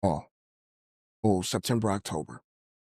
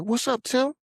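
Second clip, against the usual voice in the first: high-pitched retold hello; imitated phone greeting; 10 semitones higher than usual. Old acquaintance ringing him after years, high-pitched imitated greeting. Phone caller voiced in story.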